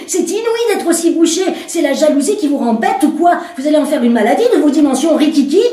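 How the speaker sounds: outraged and scornful; woman furious at the commissaire; indignant woman. Acted.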